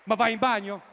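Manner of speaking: loud, high exasperated question; exasperated shout. Real, not acted. The comic scolds men peeing in the sea, exasperated shout. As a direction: throw it at them in disbelief.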